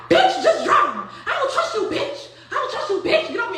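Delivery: high, loud, laughing retold shout; mock frantic yell. Noonie yelling at Crystal to get off her and drive. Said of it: "Noonie like".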